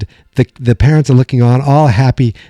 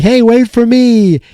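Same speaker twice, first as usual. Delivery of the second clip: high, slow mock call after the departing men; lightly voiced Lucy calling to the others who left her behind; calling after them; 10 semitones higher, slower than usual.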